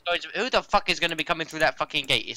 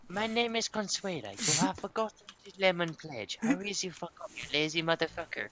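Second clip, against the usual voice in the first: hammy bossy maid voice, higher and softer; Consuela impression; 3 semitones higher, 6 dB quieter than usual. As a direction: scold like a fussy housemaid. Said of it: "I can do a Consuela".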